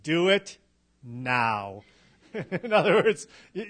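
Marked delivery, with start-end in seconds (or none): 0.0-1.8 "now" set apart after a pause, pitched low; pause, then land on a low, weighty "now"; stress on now. Shown as a demonstration. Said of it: "emphasized a word in a sentence".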